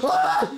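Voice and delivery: piercing high scream of fright; panicked shriek. Real, not acted. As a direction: shriek as you get hit. Monster attacks.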